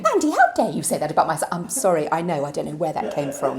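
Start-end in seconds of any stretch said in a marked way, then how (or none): 0.0-1.5 loud, fast mock indignation; hammed-up how-dare-you; mock outrage. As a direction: play the offended mother.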